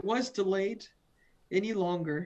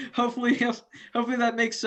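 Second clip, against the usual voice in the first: laughing; 5 semitones higher, 5 dB louder than usual. Real, not acted. Joking hint to Japan, laughs.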